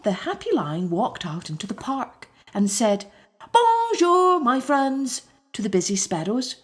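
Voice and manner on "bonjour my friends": cheerful greeting. Acted. Lion greets sparrows.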